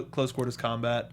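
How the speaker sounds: normal talking voice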